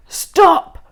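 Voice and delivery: high, loud command; loud call. A narrator's reading. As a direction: halt everyone with one bark. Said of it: "called Hank Chief".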